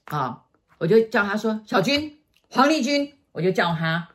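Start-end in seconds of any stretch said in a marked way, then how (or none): 1.6-3.1 high call across the street; calling out a name. Retold or imitated. Teacher calling her old name on the street, high call. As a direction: hail her from behind, pitched up. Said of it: "然后我就大叫".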